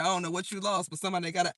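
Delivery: ordinary talk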